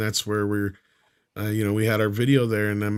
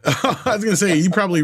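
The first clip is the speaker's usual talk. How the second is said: laughing; 9 semitones higher, 4 dB louder, faster than usual. Real, not acted. Laughing at joke.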